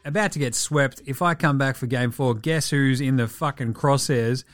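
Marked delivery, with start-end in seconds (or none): none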